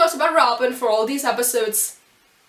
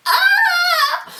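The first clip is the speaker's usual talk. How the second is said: very high overwhelmed fangirl squeal; squeal of emotion; 4 dB louder than usual. Real, not acted. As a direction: squeal out the feeling, can't contain it.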